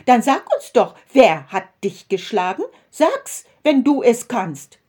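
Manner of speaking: loud, high, jeering taunt; mocking shout. A narrator's reading. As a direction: taunt him loudly, sneering. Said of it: "riefen sie".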